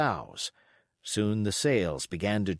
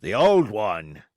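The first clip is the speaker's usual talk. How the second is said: louder, slower sailor's call; cried out; 3 semitones lower, 6 dB louder, slower than usual. Acted. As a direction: call out for the old song. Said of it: "cried another".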